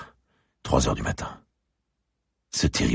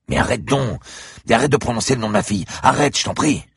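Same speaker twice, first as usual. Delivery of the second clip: much higher, loud pleading yell; desperate shout; 11 semitones higher, 5 dB louder than usual. Acted. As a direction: stop her at the top of your voice, begging. Franck yells at the girl to stop.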